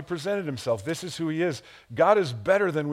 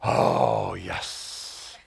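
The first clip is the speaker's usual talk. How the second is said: savoring sigh. Acted. Savouring the first coffee.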